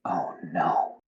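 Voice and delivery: low, whispered dismay; quiet dread. Acted. Sela as the color drains from her face, quiet dread. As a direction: half-whisper it as dread sinks in. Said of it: "the color drains from her face".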